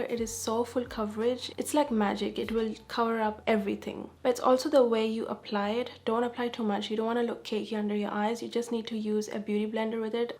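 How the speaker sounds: ordinary talk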